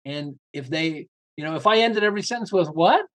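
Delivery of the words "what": "what?" ending on a sharp upward lilt; rising ending. Shown as a demonstration. Showing how ending every sentence up sounds unsure, rising. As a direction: let "what?" climb up like a question. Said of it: "with up instead of down".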